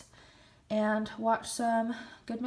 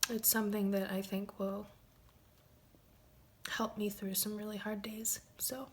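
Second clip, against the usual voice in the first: soft, unvoiced, choked up; emotional whisper; 5 dB quieter than usual. Real, not acted. Wife thanking her absent husband, tearful whisper. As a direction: barely voice it, holding back tears. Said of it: "I'm getting a little emotional".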